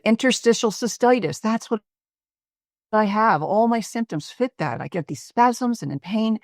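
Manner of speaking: high-pitched, insistent retold complaint; retold agitated patient. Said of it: "his voice is still loud, but a little softer".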